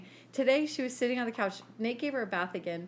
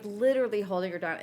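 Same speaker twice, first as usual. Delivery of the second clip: normal talking voice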